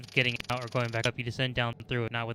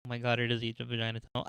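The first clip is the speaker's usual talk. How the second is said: usual speaking voice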